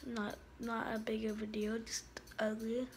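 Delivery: whisper. Real, not acted. Self-deprecating aside.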